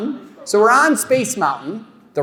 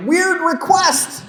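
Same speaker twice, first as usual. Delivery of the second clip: mock panic; 10 semitones higher, 4 dB louder than usual. Husband on the phone to his wife, in a hammed-up high panic.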